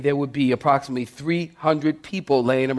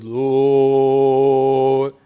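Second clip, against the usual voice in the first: long sung echo of Lord; echoing building; 5 dB louder than usual. Retold or imitated. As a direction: let it ring like a cathedral echo. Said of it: "the building goes".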